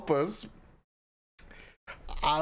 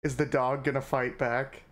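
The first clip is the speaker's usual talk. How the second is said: normal talking voice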